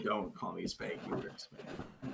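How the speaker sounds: whispered aside, barely voiced; whispering. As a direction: drop to a hushed whisper. Teasing name game, whispered reply.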